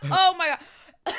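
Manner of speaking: choking cough into a loud gasp; coughing fit. Real, not acted. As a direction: cough hard, then gasp it out. Coughing after hot sauce.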